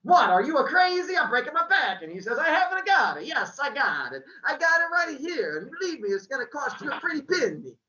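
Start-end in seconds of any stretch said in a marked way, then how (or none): 0.0-2.0 loud, high angry shopkeeper; Italian-style accent shouting; yelling. Acted. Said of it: "he's yelling at me". 2.4-7.8 high, loud Italian-style shopkeeper; Italian accent, bargaining on the phone; full Luigi accent. Acted. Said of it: "I went full Luigi on that".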